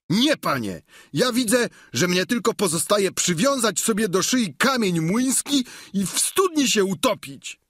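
high-pitched, aggrieved tirade; excitable, exasperated complaining; indignant servant Sancho. Acted. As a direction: reproach your master in an agitated high voice. Sancho complains to his master.

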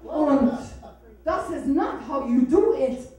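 put-on very loud German-accented rebuke; scolding German. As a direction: scold him as a domineering German woman. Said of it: "She'll also emasculate any man".

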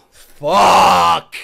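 a loud, anguished curse. Real, not acted. Viewer cursing loudly at the painful scene. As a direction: let it burst out. Fan reacts to sad scene.

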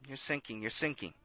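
very soft, low repeated words; hushed murmur. Real, not acted. Preacher telling the congregation Peter is sinking, hushed. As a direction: sink the voice with the words.

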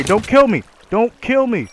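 high, loud begging cry; panicked plea. Real, not acted. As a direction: beg for your life, frantic. Attacked in the cave, pleading.

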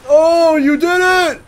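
high, slow, loud cheer; hammed-up delight; mock cheer. Mock cheer at game event.

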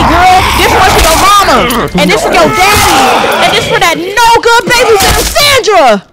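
real loud, high fight shouting; frantic yelling. Real, not acted. Fighting off Sandra in the game, loud frantic yelling. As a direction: bark every line through the struggle.

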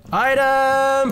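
high, held-out cry of discovery; high-pitched gleeful shout; excited call. Real, not acted. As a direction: sing out the find. Spots an item in game.

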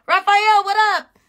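high, loud happy greeting; excited shout-out. Real, not acted. Greeting a viewer who joined the live, excited high shout-out. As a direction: call out to a friend across the room.

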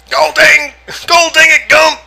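high, loud drill sergeant yell. Acted.

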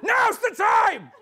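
slow, high, full-volume yell; exasperated, drawn-out scream; urgent shout. Acted. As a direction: bellow it to end the dithering. Exasperated order to hand over cigars.

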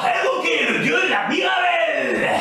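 high, loud proclaiming spirit; booming god voice. Acted. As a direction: announce yourself as a god.

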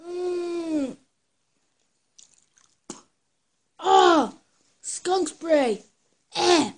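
loud, high cries of disgust; real gagging outcry at the taste; disgusted yelling. Real, not acted. Tastes skunk spray bean.

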